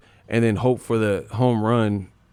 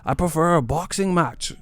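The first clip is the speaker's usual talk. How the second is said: high mock British accent, pompous preference; mock British fan; 7 semitones higher than usual. Mocking British fans claiming they prefer boxing, high mock accent. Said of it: "But now they like".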